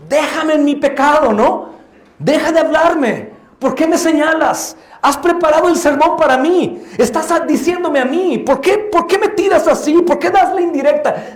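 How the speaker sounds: high-pitched, hammed-up indignation; indignant protest. Rebuked member protesting that the sermon targets them, indignant. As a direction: protest loudly and high, as if accused.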